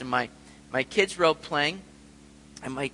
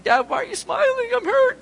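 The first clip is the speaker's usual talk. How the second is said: put-on much higher child's voice, hurt and complaining; imitating crying daughter; 5 dB louder than usual. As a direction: mimic the little girl whining through tears. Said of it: "my daughter's crying".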